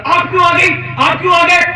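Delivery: high, loud startled question; surprised cry. A narrator's reading. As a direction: shout in shock at the unexpected visitor. Mother surprised at the door. (دروازے پر حیرت سے اونچی پکار)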